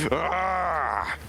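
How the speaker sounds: high exasperated yell; frustrated cry. Real, not acted.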